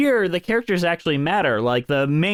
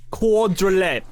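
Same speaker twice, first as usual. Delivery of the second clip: Quadruplet in a British accent, higher; 5 semitones higher, slower than usual. Shown as a demonstration. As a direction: say Quadruplet like a Londoner. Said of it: "you have to say it in a British accent".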